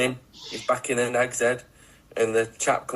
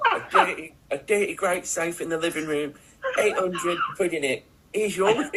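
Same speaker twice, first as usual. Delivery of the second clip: higher character voice, breaking into laughs; put-on crook impression; 6 semitones higher than usual. Podcaster resuming his story for giggling co-hosts, laughing impression. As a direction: try to hold the crook voice while laughing. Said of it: "doing that impression".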